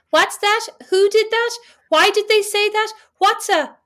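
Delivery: mimicking the kids. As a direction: pipe up like a nosy little kid. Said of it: "the children pipe up with".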